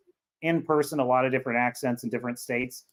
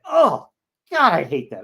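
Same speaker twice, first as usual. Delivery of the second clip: exasperated groan; 5 dB louder than usual. Real, not acted. Disgusted reaction to uptalk.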